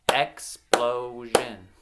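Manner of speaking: "Explosion" slowly split into three beats; syllable by syllable. Shown as a demonstration. Teacher giving children a word to blend themselves, syllable by syllable. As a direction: leave gaps between the syllables of "Explosion". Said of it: "I'm gonna clap out".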